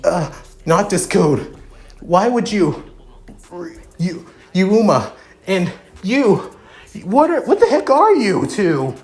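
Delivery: baffled, pitch climbing; confused. Acted.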